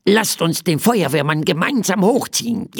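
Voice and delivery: high plush-crocodile voice; little crocodile calling. Acted. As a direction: cry out as an eager little toy creature. Crocodile voice continues. (hohe Krokodilstimme, rufend)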